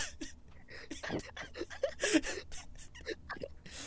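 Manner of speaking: silent breathless high laugh; helpless squeaky giggle; high wheezing laugh. Real, not acted. Cannot stop laughing.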